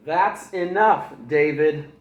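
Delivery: slow, loud warning; stern mother's loud warning; mom scolding. Acted. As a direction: lay down the final word.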